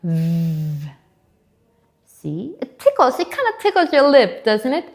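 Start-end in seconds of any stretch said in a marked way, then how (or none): 0.0-0.9 "V" as a long buzz, teeth on lower lip; the bare consonant "V", not the letter name; V sound. Shown as a demonstration. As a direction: hold "V" as a humming, ticklish buzz. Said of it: "Notice how my teeth are on top of my lower lip".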